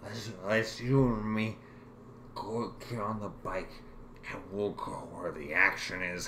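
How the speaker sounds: lightly slurred, conspiratorial; a hint of a tipsy buddy; drunk. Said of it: "in a drunken stupor".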